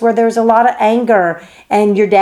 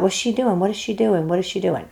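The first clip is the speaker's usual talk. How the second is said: quick, hushed repeated questioning; softer, like an inner voice fretting; wrestling brain's questioning; 7 dB quieter than usual. Acted. Brain wrestling with what the teacher does, hushed questioning. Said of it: "it'll wrestle like".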